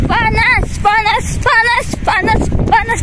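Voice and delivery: high, quick yelps on hot ground; hopping over scorching ground; walking on hot sand. Real, not acted. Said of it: "Panas sekali".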